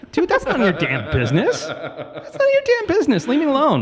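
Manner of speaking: rising, exasperated, half-laughing brush-off; mock indignant retort. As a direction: act annoyed at a nosy cashier, laughing. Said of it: "It's like".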